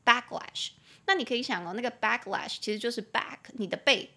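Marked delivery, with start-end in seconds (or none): 0.1-0.7 backlash with BACK louder, lash falling; stress on BACK. Shown as a demonstration. Teacher shows the stress on the first syllable, louder. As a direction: hit back, let lash drop. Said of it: "所以重音节在第一音节".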